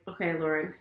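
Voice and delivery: soft, low, resigned prayer; meek surrender. Retold or imitated. Wife surrendering to God, soft and meek. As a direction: give in quietly to the Lord.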